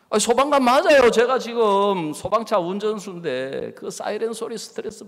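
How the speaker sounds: husband insisting loudly he is right; loud protest. Retold or imitated. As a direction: push back hard, offended.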